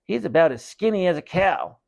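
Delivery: high teasing puppet voice; boy mocking his brother through the dummy. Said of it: "he made the dummy say".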